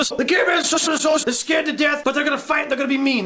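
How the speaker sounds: normal talking voice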